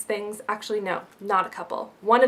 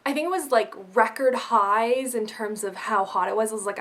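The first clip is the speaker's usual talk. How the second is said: ordinary talk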